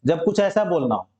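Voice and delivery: ordinary talk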